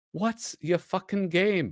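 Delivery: high, slow put-on Cockney; badly faked high Cockney voice; attempted Cockney accent. Shown as a demonstration. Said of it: "I can't do an accent".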